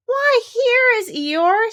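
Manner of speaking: high, slow, sing-song bear; a sweet foolish bear; Pooh voice. Acted. Pooh greets Eeyore in a high slow bear voice.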